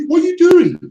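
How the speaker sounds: quoting Welbeck with a high, loud attempt at his accent; Danny Welbeck's accent. Quoting the Arsenal fan clip in a mock Welbeck accent. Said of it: "as Danny Welbeck says".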